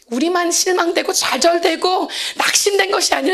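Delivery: ordinary talk, nothing marked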